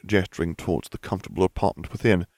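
usual speaking voice

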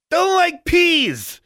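put-on high, slow, loud toddler yell; toddler shout. A narrator's reading. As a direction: scream the refusal like a tantrum. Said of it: "Alexandra shouted".

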